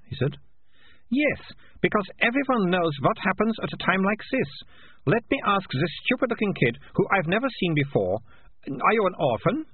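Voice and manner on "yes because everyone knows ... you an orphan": put-on high-pitched, confident girl's voice; bossy Malicia. A narrator's reading. Girl questioning a stupid-looking kid before a crowd, bossily. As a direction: take charge of the crowd in a high, lilting voice.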